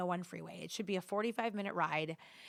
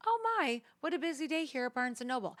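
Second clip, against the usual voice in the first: high, loud mock-cheerful dad voice; imitating dad; 6 semitones higher, 3 dB louder than usual. Daughter imitating her oblivious dad at the bookstore, high and chirpy. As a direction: pipe up like a clueless happy dad. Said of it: "he's looking around Barnes and Noble going".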